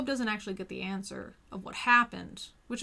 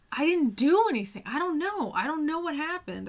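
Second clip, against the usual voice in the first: higher, louder pleading cry of innocence; desperate protest; 7 semitones higher, 6 dB louder than usual. Retold or imitated. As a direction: insist you did nothing, voice pitched up and loud. Job protests to his friends.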